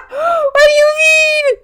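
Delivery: high, loud laughing shout; laugh-yell in disbelief; shocked. Real, not acted. Stunned at eight hours.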